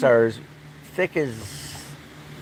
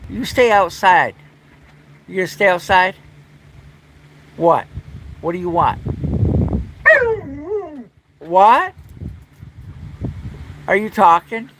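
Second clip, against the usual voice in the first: pet talk; 7 semitones higher than usual. Real, not acted. Talking to the dog Dakota.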